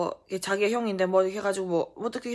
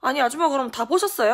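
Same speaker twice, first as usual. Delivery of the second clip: indignant retort; 6 semitones higher, 6 dB louder than usual. Retold or imitated. Child argues back at mother.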